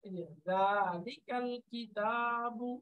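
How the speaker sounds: slow, deliberate recitation; slow careful Quran reading. Shown as a demonstration. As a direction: recite each sound with care, slowly. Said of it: "এভাবে খেয়াল করে করে".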